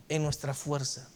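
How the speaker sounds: ordinary delivery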